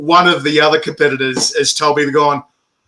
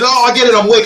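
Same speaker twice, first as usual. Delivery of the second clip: higher-pitched mock concession; mimicked retort; 7 semitones higher, 3 dB louder, faster than usual. Contestant answering the correction, mimicked high. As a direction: put on a high voice to imitate his comeback.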